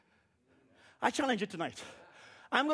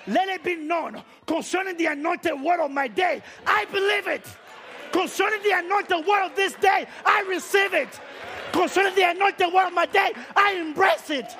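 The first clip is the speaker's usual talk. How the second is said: building louder and more forceful with each line; bold public declaration; 3 semitones higher, 3 dB louder than usual. Retold or imitated. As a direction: proclaim it firmly for everyone to hear. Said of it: "gonna go on record tonight".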